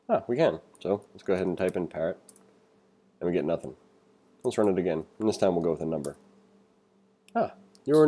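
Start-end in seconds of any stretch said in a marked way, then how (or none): none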